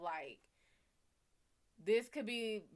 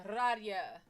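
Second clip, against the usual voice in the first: Radia said like radio with a put-on Spanish accent, louder; 6 dB louder than usual. Shown as a demonstration. Reading a viewer's name, Radia in a mock Spanish accent. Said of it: "I literally just said radio with an accent".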